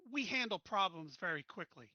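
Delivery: normal talking voice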